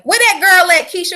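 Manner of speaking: loud and high, hollering for her; loud high call for Keisha. Real, not acted. Calling for Keisha.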